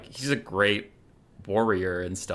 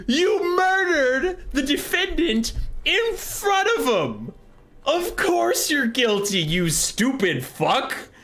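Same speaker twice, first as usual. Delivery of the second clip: exasperated rant; slower than usual. Real, not acted. Ranting to viewers about Malekith, high exasperated disbelief. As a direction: spell out the obvious in disbelief.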